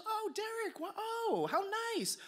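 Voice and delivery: imitated sweet mom greeting; delighted mom. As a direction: coo it high like a pleased mom on the phone. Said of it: "She goes".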